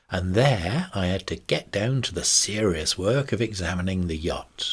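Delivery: plain reading voice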